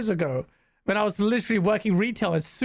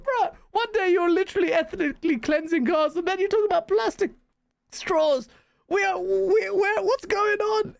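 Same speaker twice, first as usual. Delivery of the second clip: much higher, exasperated disbelief; disbelieving rant; 10 semitones higher than usual. Retold or imitated. Streamer reacting to Trump's straw post, high exasperated disbelief. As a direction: pitch up in baffled outrage.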